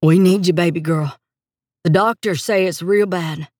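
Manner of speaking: lightly voiced uncle with Texas drawl; a hint of agony behind the drawl; agonised Texas uncle. A narrator's reading. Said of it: "the agony in Uncle Eustace's voice behind that slow Texas drawl".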